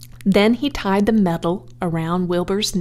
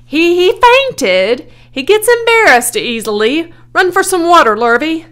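high, loud, urgent explaining; hollering; 10 semitones higher, 9 dB louder than usual. A narrator's reading. Owner sends for water, high loud holler. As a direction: holler excuses and orders over the crowd. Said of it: "hollered Zuckerman".

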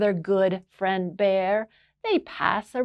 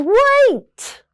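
a much higher, louder Wait!; Bear shouts; 9 dB louder than usual. Acted. Bear halting his friends' feast with a high shout. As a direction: stop everyone with a cry. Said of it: "And Bear says".

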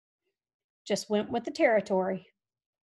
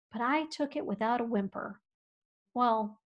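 ordinary talk

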